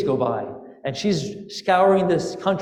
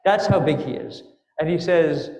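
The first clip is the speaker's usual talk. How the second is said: ordinary delivery, nothing marked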